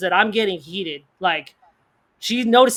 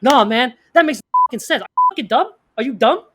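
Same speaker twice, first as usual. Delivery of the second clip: imitating a heated accuser, higher in bursts; aggressive; 4 semitones higher, faster than usual. Game host attacking a player during the debate, aggressive. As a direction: come at him hard, mocking the pushy player. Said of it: "is very aggressive ... He's coming at me hard".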